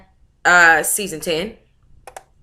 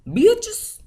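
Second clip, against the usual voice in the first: squeaky punchline; 14 semitones higher than usual. Acted.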